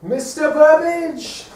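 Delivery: high, slow and loud calling out for him; drawn-out melodramatic call; stage calling. Acted. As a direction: stretch the name out across the stage. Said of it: "that's close".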